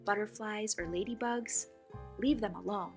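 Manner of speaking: ordinary delivery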